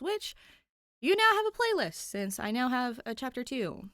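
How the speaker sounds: ordinary delivery, nothing marked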